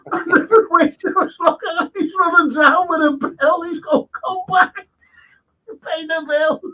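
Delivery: very high, laughter-squeezed voice; laughing retelling. Retold or imitated. Man retells waiter chasing girls over the bill, laughing high. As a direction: tell the punchline pitched right up through laughter.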